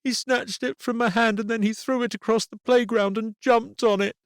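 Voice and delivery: put-on a girl insisting she was wronged; upset little girl. A narrator's reading. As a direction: daughter blaming the boy in a quarrel.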